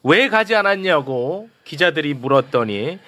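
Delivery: usual speaking voice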